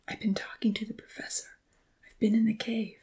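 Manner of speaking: soft voiceless whisper; hushed. A narrator's reading. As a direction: whisper so no one overhears. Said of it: "she replied in a hushed tone".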